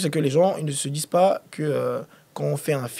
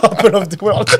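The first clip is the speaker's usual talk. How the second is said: louder silly English said through laughter; laughing mock English; 4 semitones higher, 8 dB louder, slower than usual. Real, not acted. Host joking in mock English, laughing. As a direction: ham up the English while laughing.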